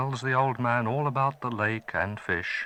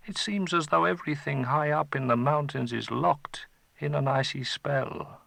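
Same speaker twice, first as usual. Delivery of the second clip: lightly voiced father, higher; a father puzzled, a hint of role; worried father; 7 semitones higher, 4 dB louder than usual. Father telling son of the frozen streams, lightly voiced puzzled.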